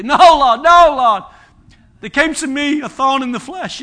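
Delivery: mock complaint to the Lord; protesting believer.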